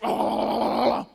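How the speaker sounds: long, loud wavering "ah" noise; imitated greedy gobbling. Player tilting the board to the hippo, loud greedy noise. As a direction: mimic scooping everything up in one go. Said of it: "and you're just like".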